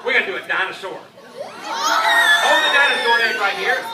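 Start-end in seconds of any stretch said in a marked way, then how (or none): 1.3-3.8 showman announcement. Acted.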